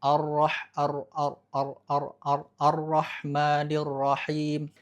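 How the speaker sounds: Ar-Rahmanir Rahim slow, ro held with a light trill; controlled ro. Shown as a demonstration. Teacher giving the correct restrained trill. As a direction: keep the ro in Ar-Rahmanir brief. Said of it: "jangan sampai terlalu banyak bergetar".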